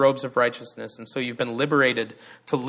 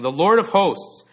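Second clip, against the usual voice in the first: raised, louder proclaiming answer; procession's proclamation; 6 semitones higher, 6 dB louder than usual. Acted. Procession answering the guards a second time, proclaiming. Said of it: "the procession would conclude by saying".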